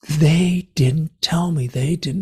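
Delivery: slow, aggrieved protest; acting out an outburst at half force; retold screaming. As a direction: draw out the accusation. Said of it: "And I'm screaming".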